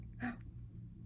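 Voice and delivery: soft shocked gasp; quiet pretend gasp. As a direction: gasp in mock surprise for the kids. Reacting to dragon again.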